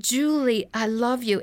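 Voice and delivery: firmer, fuller voice on the name. Retold or imitated. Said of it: "that voice got louder and louder".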